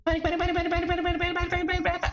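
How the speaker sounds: high frantic chant of panic. Acted. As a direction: rattle the words off in a spiralling panic. Chanting panic inside character's head.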